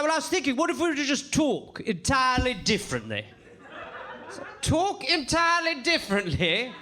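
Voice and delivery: high, hammed-up British old boy; old dusty gentleman. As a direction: play a puzzled stuffy Brit.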